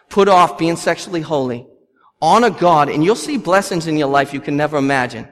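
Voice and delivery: ordinary talk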